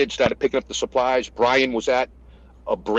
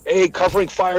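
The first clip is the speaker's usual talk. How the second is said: higher-pitched battlefield call; retold shouted order; 6 semitones higher than usual.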